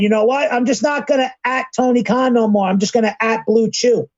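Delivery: high-pitched mocking impression; high mock Buff voice. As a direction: quote him in a high silly voice. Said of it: "so he said".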